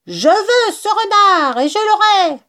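high, loud raging vow; furious cry. Acted. Bean vows to catch the fox, furious shouting. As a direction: rant with fierce determination. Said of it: "s'écria-t-il furibond".

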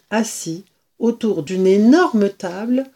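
ordinary delivery, nothing marked

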